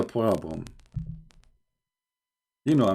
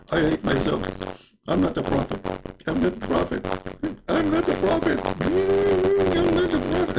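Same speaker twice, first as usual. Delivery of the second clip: mock anguished prophet. Prophet hiking up, hammed-up squeaky self-pity.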